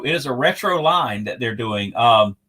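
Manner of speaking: normal talking voice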